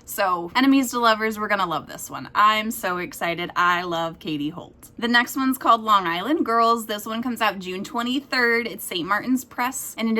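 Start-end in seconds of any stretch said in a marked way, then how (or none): none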